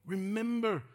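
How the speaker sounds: high, loud fervent preaching. Acted. Preacher exhorts congregation to remember the Lord.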